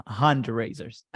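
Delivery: slow, put-on British accent; mock British pronunciation, drawn out; imitating British accent. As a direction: copy a British accent, stretching the words. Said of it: "repeat it with your British accent".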